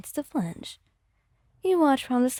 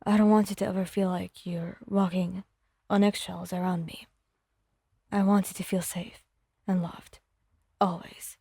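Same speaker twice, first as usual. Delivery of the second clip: soft voice; 6 semitones lower, 3 dB quieter than usual. Acted. Bakugo telling Wyon she should feel safe, softly. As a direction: lower and softer, tender reassurance. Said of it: "his voice soft".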